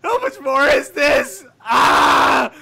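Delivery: strained loud cry from holding pitch; straining voice. Real, not acted. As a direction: push through a strained throat. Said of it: "half of my vocal cords are stretched".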